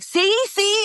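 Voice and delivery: high-pitched, insistent mock prodding; told-you-so nudge. Friend elbowing her after the star says hello, high insistent prodding. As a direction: imitate a friend nudging, voice shooting up.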